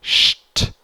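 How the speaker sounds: "sht" as a hushed sh with a light t; sht sound. Shown as a demonstration. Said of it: "S T is sht".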